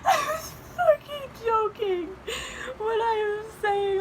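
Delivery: high, slow tearful wail; crying. Real, not acted. Reader disbelieving her joke came true, crying. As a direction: push slow words out through real sobs. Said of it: "I don't care if I'm ugly crying".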